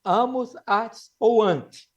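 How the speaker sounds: very slow, separating "at" and "ante"; teacher listing plural endings. Shown as a demonstration. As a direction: give each ending its own moment. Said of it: "Singular é o".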